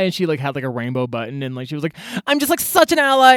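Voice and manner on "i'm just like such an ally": much higher, louder gushing cashier impression; mocking cashier. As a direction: overdo her eager self-praise.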